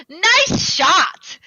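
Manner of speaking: loud, high mock congratulation after a laugh; gleeful villain cheer. Villain mocking mortified Yuji after the attack, gleefully loud. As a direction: hoot it like a delighted tormentor. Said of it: "he's like".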